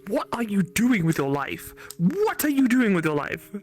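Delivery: usual speaking voice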